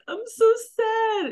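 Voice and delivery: high, slow fake-sad whimper; mock sad whine. Mocking Scheana's fake crying, high slow whine. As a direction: whine it like a faked cry. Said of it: "doing, like, this, where she's like".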